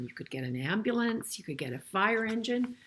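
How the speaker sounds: usual speaking voice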